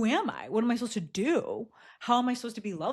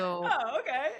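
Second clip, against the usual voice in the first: laughing; 6 semitones higher, slower than usual. Retold or imitated. Retelling her reply to a text.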